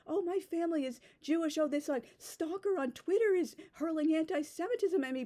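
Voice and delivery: high-pitched mock victim; mocking complaint. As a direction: imitate someone banging on, pitched well up. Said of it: "these people who bang on and on about".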